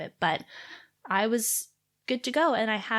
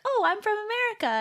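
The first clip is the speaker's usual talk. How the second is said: much higher chirpy voice; chirpy retold reply; 12 semitones higher than usual. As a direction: answer in a high, chatty lilt. Retelling her chirpy reply about origin.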